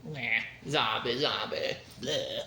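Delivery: low, soft, listless zombie mumble; imitated apathetic zombies. Reviewer imitating all the other zombies, low and listless. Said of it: "everyone else is just like".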